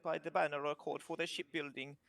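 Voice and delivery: lightly voiced kobold with an Italian lilt; proud little trader showing off his wares; mock Italian kobold merchant boasting of his lumber; kobold's accent. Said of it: "He goes".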